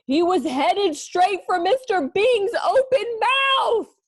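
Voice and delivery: high, loud, slow dramatic alarm; alarmed narration. A narrator's reading. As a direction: build the horror of the reveal.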